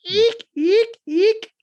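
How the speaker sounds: high squeaky dolphin chirps; dolphin squeaks. Pretend. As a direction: squeak it out like a rubbery toy dolphin. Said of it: "this is if a dolphin were trying to tell you".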